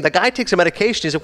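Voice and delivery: ordinary talk, nothing marked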